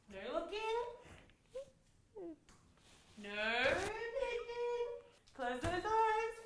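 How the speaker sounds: high, drawn-out singsong; playful singsong warning. Real, not acted. Parent hiding a surprise cake from child, playful singsong.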